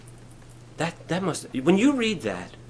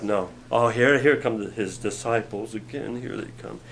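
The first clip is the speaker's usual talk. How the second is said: low, much softer groan; weary scoffers; 7 semitones lower, 5 dB quieter than usual. Retold or imitated. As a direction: sigh it out, fed up. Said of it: "they're all like".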